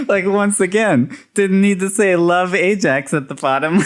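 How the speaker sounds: high giggling through the words; cracking up, pitch way up; real laughter; laughing hard. Real, not acted. Cracking up retelling.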